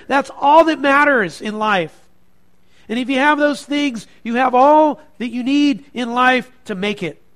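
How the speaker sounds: loud, high-pitched dismissive argument; Pilate. Acted. Preacher voices Pilate arguing his philosophy to Jesus, loud and dismissive. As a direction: argue forcefully that truth is worthless. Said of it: "That was his philosophy".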